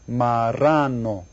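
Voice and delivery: slow, drawn-out "marrano"; syllable by syllable. Shown as a demonstration. Teacher asks for syllable count, slow "marrano". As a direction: stretch "marrano" into three long syllables. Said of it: "how many syllables are there".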